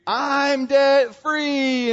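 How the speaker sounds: high, loud, slow drawn-out victory yell; imitated scream. Radio caller celebrating last debt paid, imitated scream. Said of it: "what do they scream?".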